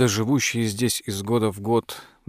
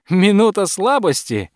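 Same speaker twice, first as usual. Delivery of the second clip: high, loud incredulous question; derisive amusement; 9 semitones higher, 5 dB louder than usual. A narrator's reading. Guest ridiculing the countess's excuse, loud and derisive. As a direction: throw it back mockingly, pitch leaping up. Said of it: "В голос расхохотался Церен".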